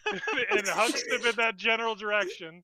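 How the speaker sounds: laughing. Real, not acted. Game player describes the axe throw, laughing. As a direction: narrate through real laughter. Cracking up while narrating the throw.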